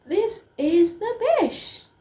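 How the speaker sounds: thrilled, high-pitched joy; overjoyed. Retold or imitated. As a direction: gush like a giddy kid. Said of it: "And I was like".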